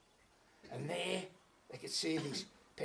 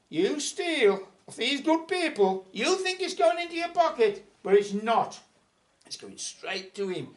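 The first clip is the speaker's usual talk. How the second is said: stern telling-off; 9 semitones higher, 10 dB louder than usual. Acted. Patrick lectures the cheating landlord.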